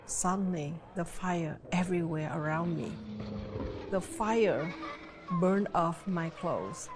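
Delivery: usual speaking voice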